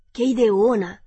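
loud, bright burst of delight; lion's delighted exclamation. A narrator's reading. Lion praising the scarecrow's idea, loudly delighted. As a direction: cheer the clever idea. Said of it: "disse il leone".

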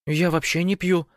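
high-pitched proud declaration. A narrator's reading. Zoika answers an accusation of drinking, proudly. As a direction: say it proudly, voice lifted. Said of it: "гордо сказала Зойка".

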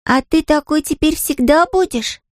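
put-on high, slow childlike question; little girl's voice. A narrator's reading. Mirra asks if change is permanent, high childlike voice.

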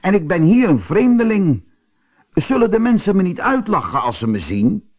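high-pitched, hesitant figure voice; self-conscious wizard. Acted. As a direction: confess the worry in a high voice. (onzekere tovenaar met hoge stem)

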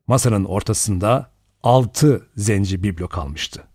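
plain reading voice

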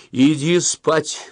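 slow, weary sigh. A narrator's reading. Tired mother sending her son to bed, slow sigh. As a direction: sigh it out slowly. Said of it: "вздохнула мама".

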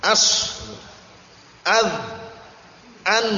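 "Ash, az, an" each ending on the next consonant, no l; lam crashed through. Shown as a demonstration. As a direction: separate syllables "Ash, az, an", last consonant held. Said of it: "Lamnya ditabrak langsung".

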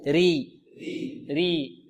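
light, thin R in ري, held for repeating; ري with a thinned R and long i; ترقيق الراء. Shown as a demonstration. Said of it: "والراء المكسورة ترقق". (ري براء مرققة)

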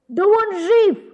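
loud high shout of alarm; horrified yell. A narrator's reading. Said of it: "кричу я вдруг во всё горло почти с ужасом".

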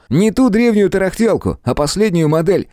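put-on very high, eager boyish voice; thrilled, high-pitched rush of pride; excited boasting. A narrator's reading. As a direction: gush proudly about the new machine. Tom boasts excitedly about new vehicle.

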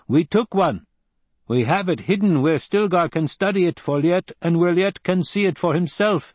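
high-pitched, unbothered tribesman voice; Fremen desert fighter, casual. A narrator's reading.

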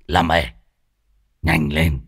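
low, grumbling hurry-up; lightly voiced workman. Thầy Muôn hurrying Lâm before the sun, low.

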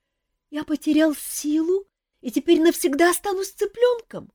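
high panicky squeak; a tiny bird in a panic; frightened chick. A narrator's reading. Chick fears staying a chick forever, high panicky. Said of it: "испуганно трепыхнул крылышками".

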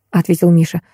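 ordinary delivery, nothing marked

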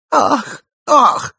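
high, loud gasping ahs; alarmed cries. Acted. Husband hears voices behind the door, alarmed. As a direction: cry out in alarm.

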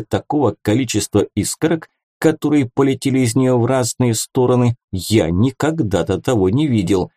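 usual speaking voice

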